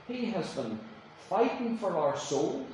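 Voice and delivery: ordinary delivery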